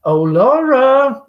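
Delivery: loud, drawn-out call toward the kitchen. Acted. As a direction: sing her name across the house. Amanda calling to the kitchen.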